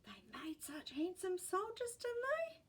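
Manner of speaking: put-on old woman voice. A narrator's reading. Great gran voiced in story.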